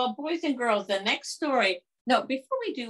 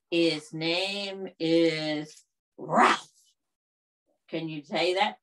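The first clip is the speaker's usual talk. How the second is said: ordinary delivery, nothing marked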